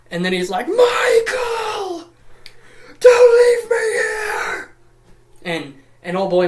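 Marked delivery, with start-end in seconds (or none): 0.7-4.7 high, loud, drawn-out cry; mock desperate wail. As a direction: scream for help, hammed-up. Trapped father crying out to son.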